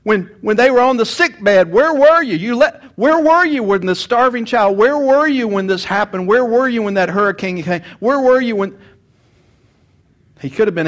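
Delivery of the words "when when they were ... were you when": rising, louder, anguished accusation to God; accusing cry. Retold or imitated. Mourners crying out at God, angry and grieving. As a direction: hurl the question at heaven. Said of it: "for accusing him".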